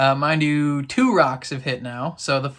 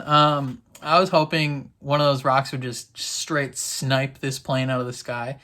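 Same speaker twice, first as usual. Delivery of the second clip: ordinary delivery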